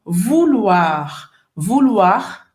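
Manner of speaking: vouloir said vu-lwar, ou as u, oi as wa. Shown as a demonstration. Teacher to students drilling the sounds of vouloir. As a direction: stress the lwar ending of vouloir. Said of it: "Presta atenção".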